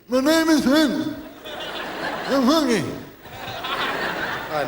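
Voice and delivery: tiny high [name] voice; [name] impression. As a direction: squeak it out like a childlike [name]. [name] again.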